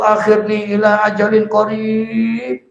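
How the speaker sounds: high, chanted begging to God; loud pleading cry. Acted. Dying sinner begging Allah not to take him yet, loud wail. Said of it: "Dengan suara yang lantang".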